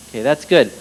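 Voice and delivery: normal talking voice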